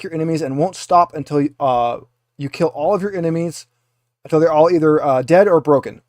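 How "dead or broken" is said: ordinary delivery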